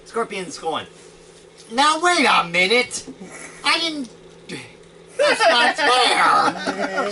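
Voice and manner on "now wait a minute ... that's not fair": loud, high protest, half laughing; a monster crying foul at a robot; indignant outburst. Acted. Monster shouting its outrage at the robot, laughing. GM voices angry Scorpion monster.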